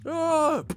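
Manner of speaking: high, sustained mock chant; long, high-pitched cult chorus "ah"; chanting crowd wail. Streamer imitates everyone chanting, high held wail. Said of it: "Everyone was chanting".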